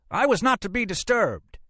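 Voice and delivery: very high-pitched outrage; indignant cry. Acted. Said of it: "he cried".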